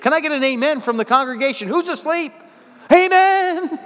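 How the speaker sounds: high, loud preacher's call; shouting for an amen from the pews; rousing call for amen. Real, not acted. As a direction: rouse the sleepy congregation. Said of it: "I'm gonna have to do more inflection in my voice".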